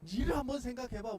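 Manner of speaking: loud, slow high rebuke; loud parental telling-off; scolding. Pretend. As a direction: scold the ungrateful child. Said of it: "채근하시고 야단치실까요".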